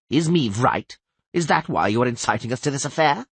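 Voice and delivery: snapping angrily. A narrator's reading. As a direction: snap the question back, sharp and quick. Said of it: "snapped Henselt".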